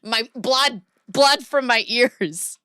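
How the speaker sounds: laughing, pained plea for it to stop; hammed-up agonised begging; mock pleading listener. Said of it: "Hound Servant, who's from the U K, was like".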